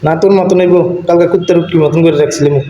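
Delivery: ordinary talk, nothing marked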